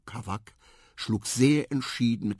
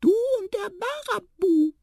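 very high, louder mouse squeak; squeaky mouse; 7 dB louder than usual. A narrator's reading. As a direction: pipe it loudly as a little mouse. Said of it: "piepste die Maus". (laut piepsende Maus)

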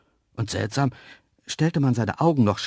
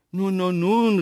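higher, louder appeasing call; 9 semitones higher, 7 dB louder than usual. A narrator's reading. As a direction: call after them to placate them. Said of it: "rief ich". (beschwichtigend rufen)

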